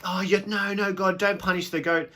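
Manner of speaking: high, loud hurried plea; pleading. Retold or imitated. People begging God to spare the goat, high and pleading. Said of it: "if we're like".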